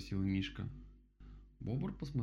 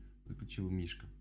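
usual speaking voice

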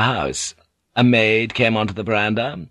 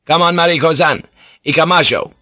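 loud, raised, imperious command; 8 semitones higher, 7 dB louder than usual. A narrator's reading. Blackthorn orders Mariko to come along, loud and imperious. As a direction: order her along like a lord. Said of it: "he grunted imperiously at Mariko".

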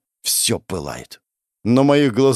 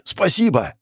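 higher, fully voiced thanks; energetic exclamation; 7 semitones higher than usual. Hero jumping to his feet, exclaiming. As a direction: call it out with sudden energy. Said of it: "сразу же подскочил на ноги".

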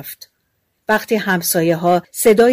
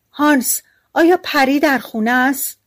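higher-pitched call, only lightly raised voice; mild calling out; 6 semitones higher than usual. A narrator's reading. Wife calling to her husband at the window, mild cry. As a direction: call out in a restrained story-reader's cry. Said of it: "پری به پنجره کوبید و فریاد زد".

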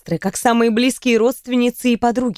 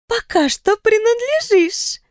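higher, slow sly voice; sly young woman; 11 semitones higher, slower than usual. A narrator's reading. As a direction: shrug it off knowingly. Riona shrugs.

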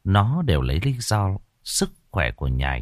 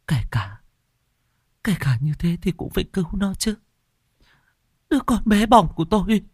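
high, fast, anguished plea; mother mourning her newborn daughter; grieving mother; 11 semitones higher, faster than usual. Acted. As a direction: cry out for the lost baby.

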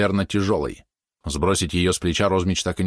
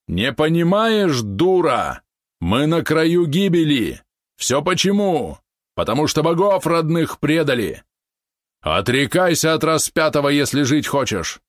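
furious shouting; 8 semitones higher, 3 dB louder, slower than usual. Acted. Uncle raging at his niece.

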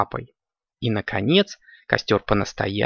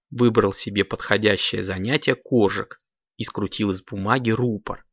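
usual speaking voice

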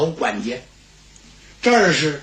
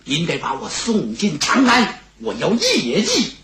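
urgent plea with sudden high peaks; determined Xue Gang; 4 semitones higher than usual. Acted. Fugitive asking the steward to smuggle him into Chang'an, determined.

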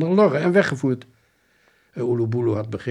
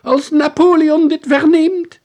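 high, loud, slow menace; threatening shout; 13 dB louder, slower than usual. Acted. Soldier dragged to the cell threatening, loud and slow. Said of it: "schreeuwde hij dreigend".

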